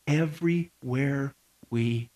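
slow, low, fading to very soft; ominous close. Real, not acted. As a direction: space out each word, sinking away. Ominous closing line of warning.

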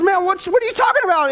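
high, quick bewildered protest; startled husband. Acted. Husband answering his screaming wife, high bewildered protest.

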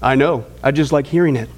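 smug little grin in the voice; smiling. Retold or imitated. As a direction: deliver the punchline low with a smile. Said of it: "Don just smiles and says".

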